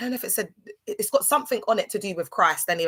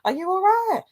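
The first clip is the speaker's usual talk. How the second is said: high mock concern; 13 semitones higher than usual.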